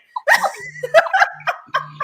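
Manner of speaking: high burst of laughter. Real, not acted. Guest amused by unplanned words, high laugh. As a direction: let a high laugh ring out.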